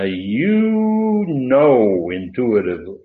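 long drawn-out, heavy "you", slowly; emphatic YOU. Acted. Preacher voicing Peter's answer to the Lord, emphatic YOU. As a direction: lean hard and long on "you". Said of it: "did you get my emphatic "you".

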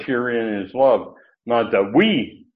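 slow reading that leans hard on "we"; emphatic reading. Shown as a demonstration. Preacher re-reading verse ten to show emphatics, stress on "we". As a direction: stretch out "we" as the emphatic word. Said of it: "I'll do it again in reading verse ten".

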